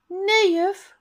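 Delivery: high, loud eager cry; calling out. Acted. Tom calls to the teacher, wanting the ice cream, loud high cry. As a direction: call out to stop the teacher. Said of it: "roept Tom".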